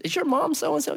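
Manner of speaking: put-on British accent. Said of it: "someone with a British accent".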